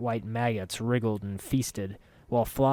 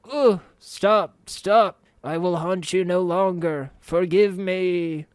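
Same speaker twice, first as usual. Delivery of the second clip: pleading ghost; 8 semitones higher, 5 dB louder, slower than usual. Acted.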